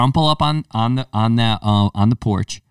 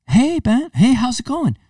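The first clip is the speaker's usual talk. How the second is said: put-on much higher, overly polite kid voice; the grown kid greeting Ben sweetly, much higher; 14 semitones higher, slower than usual. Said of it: "He doesn't say".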